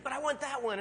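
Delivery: put-on high child's voice begging; whiny little boy. Son begging dad for the dart gun, high and whiny. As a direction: plead like a kid spotting a toy. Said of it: "He's like".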